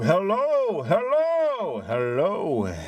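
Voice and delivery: calling into a tube. Pretend. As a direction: call down the tube, playful. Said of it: "I'm talking to the tube".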